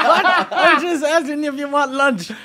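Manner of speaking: high, loud, laughing protest; mock bafflement. Lunch asker answering the outburst, comically bewildered. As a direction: protest innocently, pitched right up.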